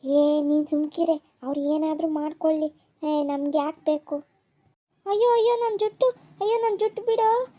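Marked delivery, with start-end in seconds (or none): 5.1-7.5 high, loud yelps as her hair is pulled; pained cry. Acted. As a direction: cry out in pain, begging him to let go. (ಜುಟ್ಟು ಎಳೆದಾಗ ನೋವಿನಿಂದ ಕಿರುಚುವುದು)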